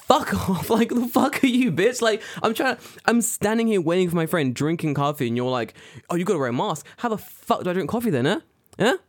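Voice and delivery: high, heated retort; mad. Retold or imitated. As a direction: retell an indignant outburst with real bite. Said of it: "I was so mad".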